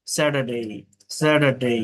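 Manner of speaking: "স্যাটারডে" ending in a full diphthong day; correct day ending. Shown as a demonstration. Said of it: "আবার বলছি".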